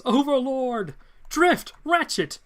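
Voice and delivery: high, loud dramatic exclamations; calling out. Acted. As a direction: cry out each name in alarm, pitched well up. Acted hero calling out names.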